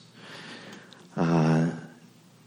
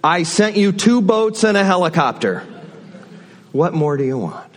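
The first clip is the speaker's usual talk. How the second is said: God's reply; 10 semitones higher, 4 dB louder than usual. Retold or imitated. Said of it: "And God, of course, says".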